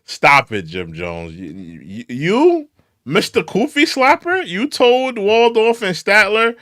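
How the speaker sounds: loud, rising exasperated callout; heated rant. Real, not acted. Streamer yelling at [name] on the clip, heated and loud.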